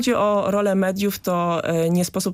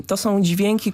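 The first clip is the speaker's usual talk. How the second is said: usual speaking voice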